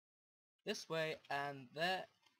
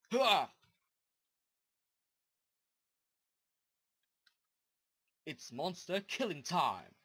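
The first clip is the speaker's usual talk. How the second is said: loud gung-ho soldier; 2 semitones higher, 10 dB louder than usual. Pretend. Charging off to fight monsters.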